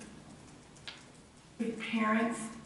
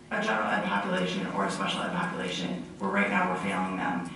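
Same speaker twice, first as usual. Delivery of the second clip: ordinary delivery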